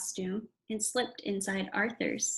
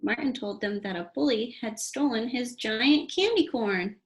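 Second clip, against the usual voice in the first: usual speaking voice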